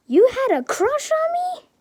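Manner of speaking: very high, rising disbelief; astonished question. A narrator's reading. Inko learns of All Might's crush, astonished. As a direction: shoot the pitch right up in surprise.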